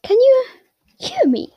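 a touch of wonder; startled boy asking; surprised Harry. Acted. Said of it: "Harry goes".

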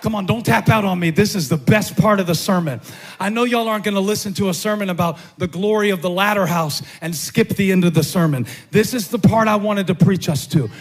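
high, driving sermon delivery; full-force preaching. Real, not acted. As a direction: preach it with building intensity, up into a shout.

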